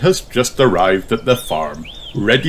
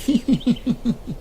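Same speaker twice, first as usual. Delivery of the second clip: giggle; 8 dB quieter than usual. A narrator's reading. Peppa laughs after song.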